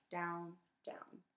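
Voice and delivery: ordinary delivery, nothing marked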